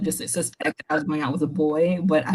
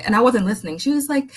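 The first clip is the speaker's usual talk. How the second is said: ordinary delivery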